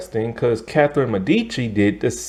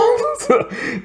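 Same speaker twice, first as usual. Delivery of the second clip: high, short burst of laughter; amused laugh. Real, not acted. Reviewer tickled by nuns playing kickball, amused laugh.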